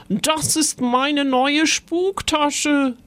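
höher, langsam gedehnte Mutterstimme; Gespenstermama klagt. Acted. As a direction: beklag die ruinierte Tasche. Mama klagt über Tasche. (klagende Mama, höher und langsam)

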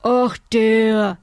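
loud, dismissive uncle with mouth full; gruff uncle voiced while eating. A narrator's reading. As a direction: talk through a mouthful. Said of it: "mit vollem Mund".

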